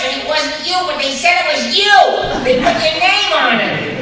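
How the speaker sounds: high, loud mock outrage; indignant accusation. Acting out an angry accusation.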